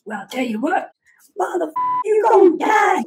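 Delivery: very high, strained cartoonish holler; hammed-up officer's furious high-pitched yell; yelling drill instructor. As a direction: threaten a recruit in an exaggerated screech. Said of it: "he just starts yelling at me".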